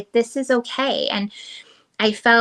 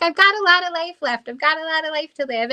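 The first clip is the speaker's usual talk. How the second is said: reassuring; 7 semitones higher, faster than usual. Retold or imitated. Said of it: "I'm like".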